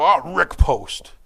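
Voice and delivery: mock exclamation. Pastor joking about hitting his thumb, mock yelp. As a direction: yelp a friend's name as a pretend curse. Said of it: "I hit my thumb with a hammer and I go".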